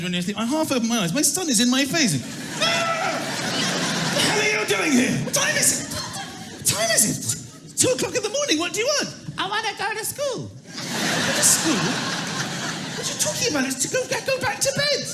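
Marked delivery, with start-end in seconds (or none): 2.6-9.1 high, fast laughing yelp of shock; startled dad. Acted. Dad waking to find his son in his face. 9.4-10.5 put-on high, soft kid voice; small child. Little son at 2am announcing he wants school. 10.5-15.1 high, disbelieving laughing whisper-shout; baffled dad; incredulous dad. Acted. Dad at 2am baffled by his son.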